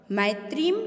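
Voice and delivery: usual speaking voice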